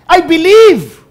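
loud, high-pitched desperate plea; acting out a father's anguished cry. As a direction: cry it out loud, slowly, at the top of the range. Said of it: "na umiiyak, sabi niya".

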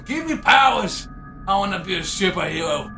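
ordinary talk

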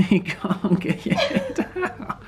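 laughing through the words; can't stop laughing while talking. Real, not acted. Friend stuck, laughing at her.

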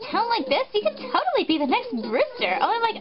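put-on high, excited Valley girl squeal. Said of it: "I'm doing a Valley voice for her".

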